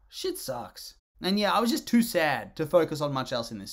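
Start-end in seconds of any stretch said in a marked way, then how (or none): 0.0-0.9 sad sigh. Real, not acted. Sad about the dead actor.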